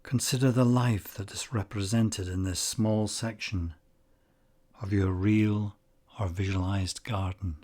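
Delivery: unhurried meditation guide, long pauses between phrases; calm, measured guiding voice, phrase endings gently falling away; guided meditation. Acted. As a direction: ease the listener into calm focus, leaving space after each phrase.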